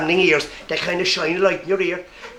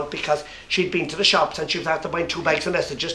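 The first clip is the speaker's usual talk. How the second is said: ordinary delivery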